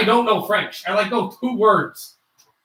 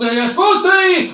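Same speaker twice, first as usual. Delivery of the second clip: excited call; 10 semitones higher, 5 dB louder than usual. Shown as a demonstration. Goal chance called out.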